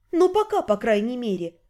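ordinary delivery

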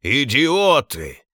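slow, loud shriek of Idiots. A narrator's reading. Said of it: "внезапно завизжал Главк".

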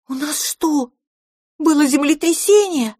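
high-pitched disbelieving question; stunned little character voice; incredulous. A narrator's reading. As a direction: ask it high, as if you can't believe your eyes. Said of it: "Пипина не могла поверить своим глазам".

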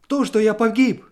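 high, loud anguished outburst; a man crying out his doom. A narrator's reading. Husband cries to his wife that he's lost, anguished.

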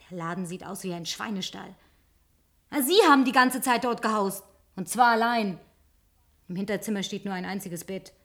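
usual speaking voice

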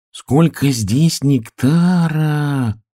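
high, slow wonder; marvelling piglet, words stretched high; amazed. A narrator's reading. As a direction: draw it out in awe.

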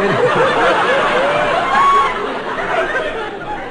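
high burst of helpless laughter; real laughter. Real, not acted. Host cracking up at his partner, laughing hard. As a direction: crack up mid-sentence. Breaks up laughing mid-line.

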